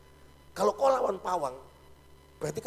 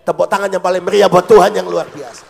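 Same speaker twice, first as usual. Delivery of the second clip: loud call for applause; rally the congregation, full voice; rousing call; 18 dB louder than usual. Real, not acted. Preacher calls the church to clap for God, loud rally.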